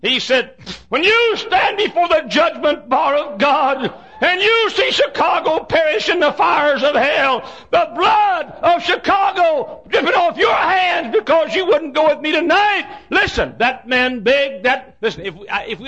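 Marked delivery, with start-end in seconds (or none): 0.9-12.9 high, slow, shouted doom; retold coach shouting judgment at the reluctant preacher; hellfire warning.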